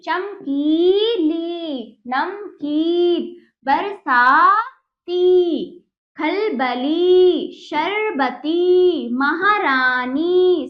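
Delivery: each word drawn out slowly for pupils; slow, loud teacher's drill of words with long ई; slow careful word reading. Shown as a demonstration. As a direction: read every word slowly, stretching the long ई. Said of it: "हमने बड़ी की मात्रा का उच्चारण शुद्ध रूप से किया".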